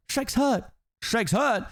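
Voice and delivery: high, repeated alarm ending on a rise; frantic panic. Acted. Donkey learning his friend is hurt, frantic and high. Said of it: "Donkey frantically begins to scamper around".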